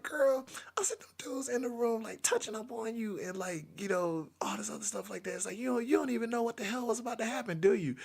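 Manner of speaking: high, soft, strained scolding; hushed exasperation. Retold or imitated. Boyfriend telling her what nearly happened, high hushed exasperation.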